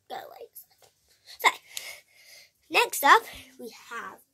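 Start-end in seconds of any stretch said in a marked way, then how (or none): 0.1-0.5 whispered go away; hushed dismissal. Real, not acted. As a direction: shoo them off in a whisper. Shooing someone off.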